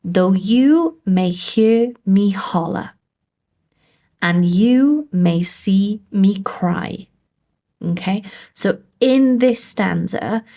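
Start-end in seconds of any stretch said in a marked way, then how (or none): 0.0-2.9 slow demo with "you", "hear" and "holler" leaned on; teacher's exaggerated iambic reading; stressed and unstressed beats. Shown as a demonstration. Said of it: "So just listen again". 4.2-7.1 slow iambic reading, "you" drawn out and lifted; teacher's exaggerated da-DUM rhythm; stressed and unstressed beats. Shown as a demonstration. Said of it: "So just listen again".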